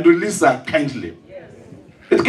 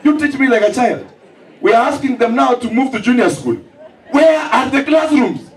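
high, impassioned rally speech; preaching at full force; 10 semitones higher than usual. Real, not acted. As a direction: thunder it at the crowd like a preacher. Fiery speech on teacher transfers.

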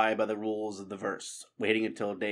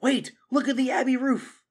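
much higher and louder badger voice, urgent; Constance murmuring; 7 dB louder than usual. Constance alerting friends to the abbey roof, urgent high voice. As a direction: point it out eagerly. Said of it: "Constance murmured".

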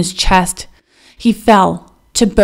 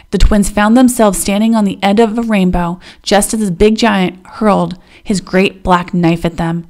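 usual speaking voice

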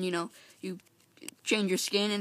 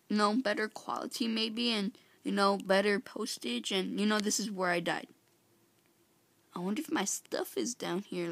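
usual speaking voice

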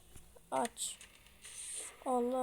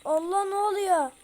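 panicked; 5 semitones higher, 7 dB louder than usual. Real, not acted. Sudden scare in game.

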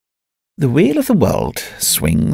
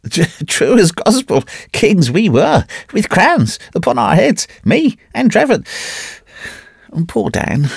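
put-on high, strained, half-mad old wanderer; a wrecked man clutching at his story; ragged Carnehan; 11 semitones higher than usual. A narrator's reading. Broken wanderer telling his old friend the tale, high and strained.

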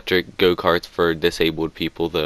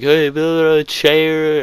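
higher, louder, slowly drawn-out call; mock stern manager; 10 semitones higher, 5 dB louder, much slower than usual. Store manager scolding the boys over the chairs. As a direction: imitate the manager dragging the words out. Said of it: "he was like".

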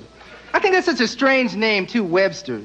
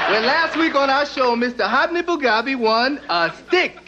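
mock game show host; 2 semitones higher than usual. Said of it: "some guy coming on T V going".

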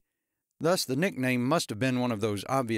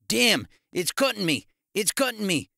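whimpering; 9 semitones higher, 3 dB louder than usual. A narrator's reading. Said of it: "Darren whimpered".

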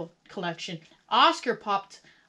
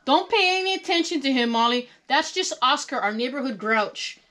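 Ernie; 5 semitones higher, 5 dB louder than usual. A narrator's reading. Friend explains the grouch to Molly, in character. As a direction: call out reassurance from hiding. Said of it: "Ernie's voice came from his hiding place".

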